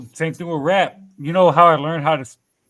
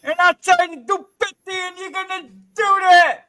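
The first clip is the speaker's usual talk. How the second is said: much higher put-on voice with laughs; 12 semitones higher than usual. Shown as a demonstration. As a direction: squeak it up high, half laughing. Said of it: "when you talk up here".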